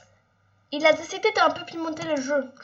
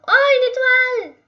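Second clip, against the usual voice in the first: high, loud delighted cry; much higher and louder gleeful surprise; surprised; 9 semitones higher, 10 dB louder than usual. Real, not acted. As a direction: exclaim as you spot it. Finds a star.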